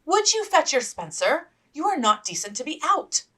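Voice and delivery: put-on older sister scolding. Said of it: "Elizabeth scolded".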